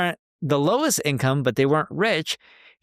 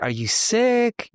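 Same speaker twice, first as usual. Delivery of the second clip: gentle worried father; 9 semitones higher, much slower than usual. Retold or imitated. Father gently asking his crying daughter, higher and slow. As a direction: coax it out of a sad little girl.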